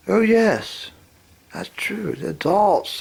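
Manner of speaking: ordinary talk, nothing marked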